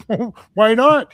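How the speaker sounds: mock stunned challenge; retold incredulous question. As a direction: push back in open disbelief. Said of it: "I'm like".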